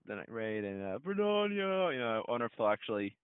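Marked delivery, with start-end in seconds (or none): 1.0-1.9 high shouted war cry; battle cry. Retold or imitated. Quoting the trailer battle cry.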